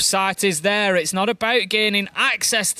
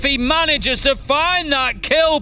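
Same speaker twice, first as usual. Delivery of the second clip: rising high, drawn-out hype call; excited call; 8 semitones higher, much slower than usual. Real, not acted. Caster calling the clutch kill, high and thrilled. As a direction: let it soar as the clutch lands.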